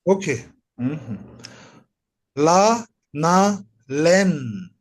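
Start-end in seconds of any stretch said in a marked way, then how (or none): 2.3-4.7 La, na, len read syllable by syllable; teacher reading La, na, len; slow reading demo. Shown as a demonstration. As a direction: read La, na, len out clearly for the class. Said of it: "can you repeat the reading again".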